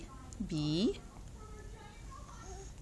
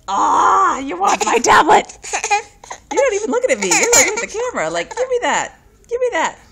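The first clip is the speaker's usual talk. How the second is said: much higher and louder fake crying whine, half laughing; mock whiny toddler; 12 semitones higher, 12 dB louder than usual. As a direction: imitate a toddler begging for the tablet. Voicing toddler whining for tablet.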